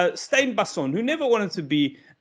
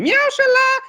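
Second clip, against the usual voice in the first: very high, loud squealing meow; cat meow; 6 dB louder than usual. Retold or imitated. Comedian imitates the cat next door, squealing high and loud. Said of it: "a cat next door going".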